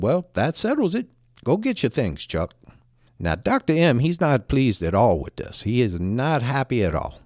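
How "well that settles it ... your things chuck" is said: lightly imitated Australian accent. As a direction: retell the doctor with a faint Australian accent. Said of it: "in his Australian accent said".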